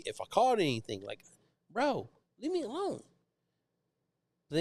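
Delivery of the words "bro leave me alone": high-pitched weary whine; exasperated plea. Retold or imitated. Fisherman to nosy stranger, exasperated plea. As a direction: whine it as an imagined brush-off.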